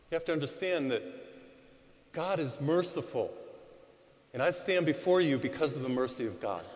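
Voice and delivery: usual speaking voice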